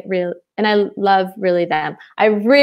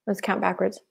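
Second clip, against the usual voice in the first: whispering; 9 dB quieter than usual. Real, not acted. Counting months under her breath.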